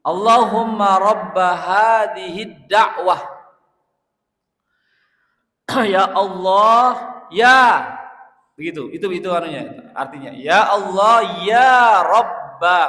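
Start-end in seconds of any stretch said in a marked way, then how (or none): 0.1-7.8 loud, slow, higher impassioned invocation; fervent preaching. Acted. Preacher crying out the prayer to the congregation, loud and impassioned. 10.3-12.8 loud, slow, higher impassioned invocation; fervent preaching. Acted. Preacher crying out the prayer to the congregation, loud and impassioned.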